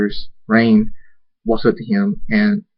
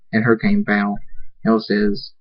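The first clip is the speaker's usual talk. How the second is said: ordinary delivery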